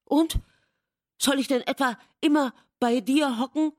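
high, loud, irritated questions; annoyed. A narrator's reading. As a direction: throw it back crossly. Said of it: "fragte sie ärgerlich".

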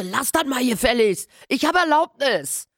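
put-on boy yells at the two attackers, high and furious; angry shout. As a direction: scream at the two men to stop. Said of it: "die beiden Kerle wütend anschreien".

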